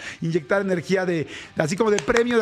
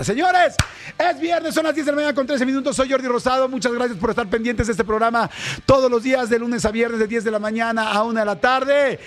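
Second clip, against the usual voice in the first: higher, fast, punchy song intro; hyped radio announcer; 5 semitones higher than usual. Acted. Radio host hyping listeners before the song, high energetic announcer. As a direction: pump the audience up for Friday.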